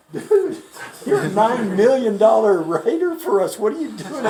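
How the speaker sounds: high, loud disbelief at a friend; mock incredulous outburst. Manager to star salesman about his side job, loud incredulity.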